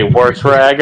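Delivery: "wash rag" said as "worsh rag"; wash with added R. Retold or imitated. Panelist mimicking people they know, "worsh" with an R. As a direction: imitate the R slipped into "wash". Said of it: "so many people that say".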